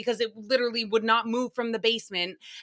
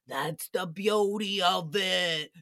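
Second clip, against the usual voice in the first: mock Sebastian; 4 semitones lower, slower than usual. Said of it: "Sebastian's like".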